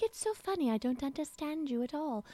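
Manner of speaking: high-pitched imitation with bizarre American accent; put-on high character voice, odd American accent; Odile. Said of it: "her very bizarre, non, you know, American accent".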